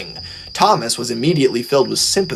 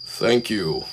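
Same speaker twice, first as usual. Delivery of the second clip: softer, stiff formal thanks; 2 semitones lower, 5 dB quieter than usual. A narrator's reading. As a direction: thank them as stiffly as possible. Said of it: "in the stiffest possible voice".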